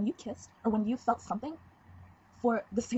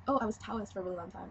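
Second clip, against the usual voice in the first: ordinary delivery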